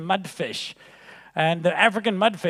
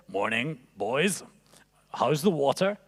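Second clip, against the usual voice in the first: put-on old fish; 6 semitones lower, 6 dB quieter than usual. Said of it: "this old fish swims past them and says".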